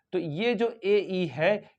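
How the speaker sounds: usual speaking voice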